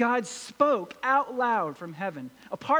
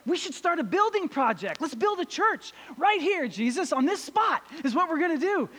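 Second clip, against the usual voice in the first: higher, eager rush of a new plan; excited Peter; 6 semitones higher than usual. Retold or imitated. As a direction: blurt it out like an excited disciple. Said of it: "He gets so excited about stuff".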